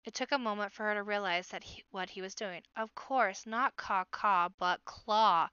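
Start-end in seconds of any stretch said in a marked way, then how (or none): 2.8-5.5 louder, drawn-out "claw" after a quicker "caw, caw"; stress on CLAW. A narrator's reading. Sister working out the baby's word, stressing "claw". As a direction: land hard and slow on the word "claw".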